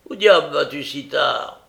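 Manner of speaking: angry demand. Acted. Brigand challenges Nancy as she tries to go out, angry demand. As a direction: demand an answer roughly.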